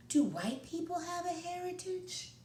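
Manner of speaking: put-on high child voice asking; curious little boy. Mancho asks his grandpa a question, high and curious. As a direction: ask it like a wondering kid.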